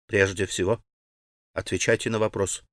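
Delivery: ordinary delivery, nothing marked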